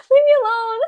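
high, loud protest after a laugh; playful protest. Real, not acted. Streamer teased by chat about a crush, playful loud protest. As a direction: cry out high and loud, mock offended.

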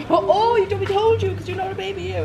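high playful teasing. Real, not acted. Mum teasing her daughter about being a baby, high and playful. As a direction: tease your little one fondly.

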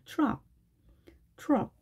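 트럭 as English truck, onset halfway to "ch"; fuse T and R in 트럭; between T and CH. Shown as a demonstration. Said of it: "트와 츠의 중간에 어디쯤의 느낌".

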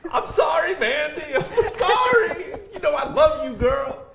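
high, loud, hammed-up pleading; mock apology. Host to teased commenter Mandy, hammed-up apology. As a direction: overdo the apology, half laughing.

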